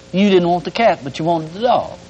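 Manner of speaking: usual speaking voice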